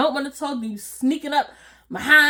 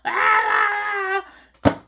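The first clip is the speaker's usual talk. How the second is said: mock scream; 8 semitones higher, slower than usual. Being tackled into the snow.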